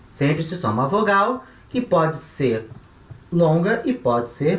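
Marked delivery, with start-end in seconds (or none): none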